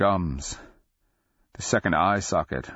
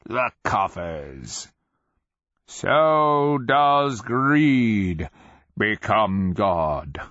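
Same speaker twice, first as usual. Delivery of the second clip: crazed voice; 3 semitones higher, 4 dB louder, much slower than usual. A narrator's reading. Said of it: "Sarl's crazed voice".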